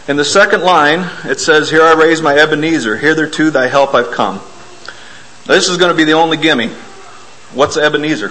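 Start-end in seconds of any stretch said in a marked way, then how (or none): none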